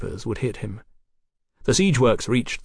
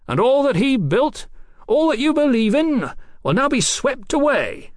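very high, ringing proclamation; terrible conviction; 14 semitones higher, 3 dB louder than usual. A narrator's reading. Captain foretells the realm's downfall, crying out with conviction. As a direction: proclaim doom with total conviction. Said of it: "with a terrible conviction of truth".

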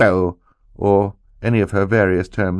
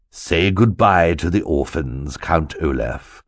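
low, slow, sinister drawl; a menacing henchman taunting; sly villain; 4 semitones lower, slower than usual. Acted.

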